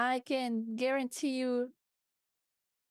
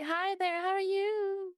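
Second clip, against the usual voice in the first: mock greeting, pitched way up; over-the-top streamer voice; 8 semitones higher, slower than usual. VTuber mimicking an out-of-this-world greeting, exaggerated high voice. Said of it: "be, like, out of the world".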